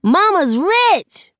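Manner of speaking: put-on high, slow little kid yelling with joy; a kid cheering at the news; excited shout. A narrator's reading. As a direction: shout it out like a thrilled little boy. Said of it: "while Sakani shouts".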